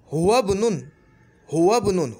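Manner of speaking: ordinary talk, nothing marked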